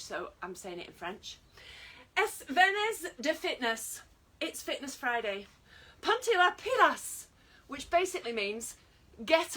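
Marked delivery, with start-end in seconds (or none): 2.2-4.0 loud, high, slow Spanish announcement; shouted Spanish. Shown as a demonstration. Instructor announcing Fitness Friday in Spanish, shouted. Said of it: "have to shout it". 6.0-7.2 high, loud rallying cry in Spanish; shouted Spanish. Shown as a demonstration. Instructor urging viewers to get up and going, shouted. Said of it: "have to shout it".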